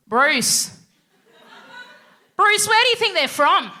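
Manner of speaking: high, loud nosy suburban wife; nosy Aussie neighbour. Retold or imitated. Pam asking husband Bruce about the new family, high and loud. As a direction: call out to husband, puzzled.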